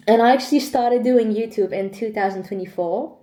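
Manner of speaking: ordinary talk, nothing marked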